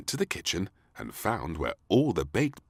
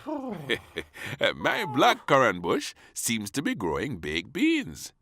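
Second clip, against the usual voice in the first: slow, a bit higher puzzled gardener; 8 semitones higher, 5 dB louder, slower than usual. A narrator's reading. Gardener reports beans growing on his bush. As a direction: slowly puzzle over it like a gardener. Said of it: "said the gardener".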